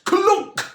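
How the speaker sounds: high, loud sound-effect word; loud clunk. A narrator's reading. Narrator making the door slam, loud clunk. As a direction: punch it like a slam.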